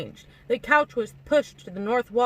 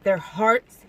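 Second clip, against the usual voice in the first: louder, incredulous echo; scoffing; 5 dB louder than usual. A narrator's reading. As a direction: throw the phrase back with scorn. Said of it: "he scoffed".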